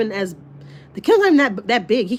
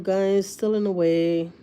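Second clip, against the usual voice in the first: normal talking voice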